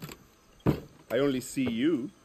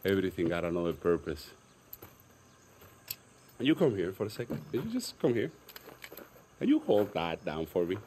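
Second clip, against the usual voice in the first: normal talking voice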